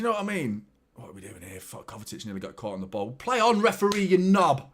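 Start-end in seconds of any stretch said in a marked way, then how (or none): 3.1-4.7 loud, high indignant shout; yelling at ref. Real, not acted. Fan shouting at the referee to play on, loud indignant yell. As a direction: throw it at the ref in disgust.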